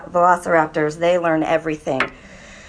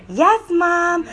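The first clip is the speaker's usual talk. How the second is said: high, lilting singsong answer; playful singsong, pitch way up; 9 semitones higher than usual. Shown as a demonstration. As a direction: chant it sweetly high like a tune. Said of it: "Sing song".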